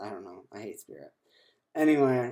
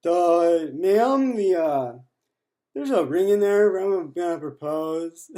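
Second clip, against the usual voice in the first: mock dopey voice, much higher and louder, slow at the end; dumb voice; 7 semitones higher, 16 dB louder, slower than usual. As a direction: play the clueless guy blurting it out. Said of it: "I can't tell her, like".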